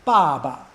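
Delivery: "Ba, ba" as loud falling syllable, then short neutral one; forceful falling "Ba", brief unstressed "ba"; fourth then fifth tone. Shown as a demonstration. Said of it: "it is a fourth tone".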